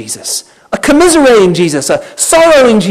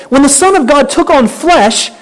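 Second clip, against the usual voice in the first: ordinary delivery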